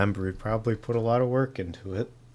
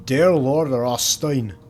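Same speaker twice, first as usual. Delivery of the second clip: slow, louder, hammed-up Irish reading; bad Irish accent; 4 semitones higher, 7 dB louder, slower than usual. Streamer reads a groundskeeper's letter in a bad Irish accent. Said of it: "That was a bad accent".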